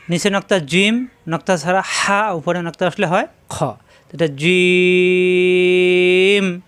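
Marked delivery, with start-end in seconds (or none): 4.3-6.6 জিম with a very long level ee, closing on m; elongated letter. Shown as a demonstration. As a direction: hold the vowel of জিম for seconds. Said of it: "জিম লম্বা করা যাচ্ছে". (অনেক লম্বা করে টেনে জিম)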